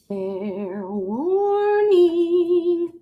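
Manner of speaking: auctioneer drawl. Acted. Auctioneer calling final bids.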